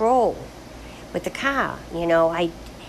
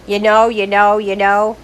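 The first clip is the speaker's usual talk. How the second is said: louder repeated filler; mocking own habit; 7 dB louder than usual. Herself mocking her old habit, louder filler. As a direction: poke fun at your old verbal tic. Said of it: "It used to be".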